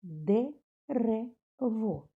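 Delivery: «Дерево» stretched very slowly, syllable by syllable, ДЕ-РЕ-ВО; чітко по складах, «Де-ре-во»; split into syllables. Shown as a demonstration. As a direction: clap out «Дерево» slowly for children. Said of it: "Так, три склади".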